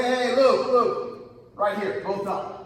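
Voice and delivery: loud, high call across the mat; urgent loud call to a teammate. Retold or imitated. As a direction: yell to get his attention mid-match. Wrestler calling to teammate mid-match.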